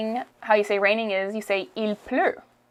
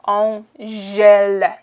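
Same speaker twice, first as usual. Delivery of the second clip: "On gèle" drawn out, louder; teacher models "On gèle" slowly; slow demo; 5 dB louder, much slower than usual. Shown as a demonstration. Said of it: "We say".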